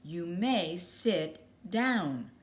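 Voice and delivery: slow, firm repeated order; the teacher repeats her order to a pirate. Acted. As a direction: spell it out slowly, firmly. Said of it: "repeated the teacher".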